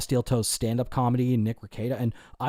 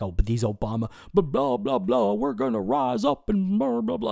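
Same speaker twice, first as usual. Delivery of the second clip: high, sing-song blah-blah speechifying; mocking politician speech; 11 semitones higher than usual. Mocking the kind of speeches Trump never gives, high sing-song. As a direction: parody a windy politician, much higher.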